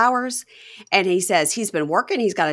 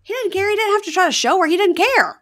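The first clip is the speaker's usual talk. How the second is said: high, louder insistence; exasperated emphasis; 12 semitones higher, 3 dB louder than usual. Real, not acted. Friend venting about the cheater, insisting hotly. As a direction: hammer home that he didn't care.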